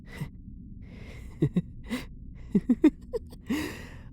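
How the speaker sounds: long, very high, breathy giddy laugh. Acted. Elf prisoner laughing giddily at the guard's love. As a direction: dissolve into a rising, breathless laugh. Overjoyed at the confession.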